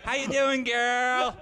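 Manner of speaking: high, hammed-up teasing impression; Andrew. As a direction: pitch it way up as a mocking greeting. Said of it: "talk to Andrew in real life".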